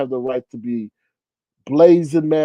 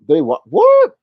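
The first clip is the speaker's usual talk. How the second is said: much higher and louder shocked what; 10 dB louder, slower than usual. Real, not acted. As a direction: blurt it in disbelief. Shocked at a comment.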